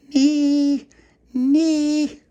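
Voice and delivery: put-on very high, loud baby squeal; piercing baby-talk call; baby shouting. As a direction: shriek it like a toddler calling. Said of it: "she shouted through the letterbox".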